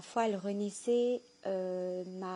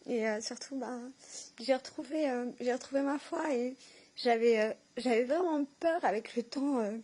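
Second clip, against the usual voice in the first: tearful, pitch raised, halting between phrases; moved to tears; 3 semitones higher than usual. Real, not acted. Woman recalling being misunderstood and regaining her faith, tearfully moved. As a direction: keep talking through real tears of emotion. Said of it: "c'est vraiment des larmes de joie".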